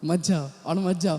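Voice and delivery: low, soft mock urging; imitated discouraging voice. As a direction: quietly imitate someone holding you back. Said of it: "बोला". (धीमी, नीची आवाज़ में रोकने की नकल)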